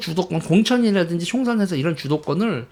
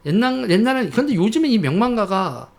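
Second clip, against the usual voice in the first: ordinary talk, nothing marked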